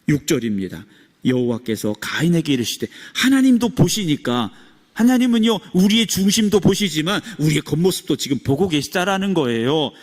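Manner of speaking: ordinary talk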